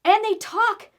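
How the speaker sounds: high, loud panicked shout. Acted. Pirate child yells at friends to run, loud and high. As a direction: yell at the others to flee. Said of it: "shouted the pirate".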